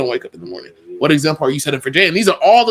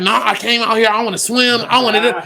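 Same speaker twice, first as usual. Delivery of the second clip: higher, whiny mock wife voice; mimicking wife; 8 semitones higher than usual. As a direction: play the wife insisting on swimming, higher and pleading. Wife voiced in retold argument.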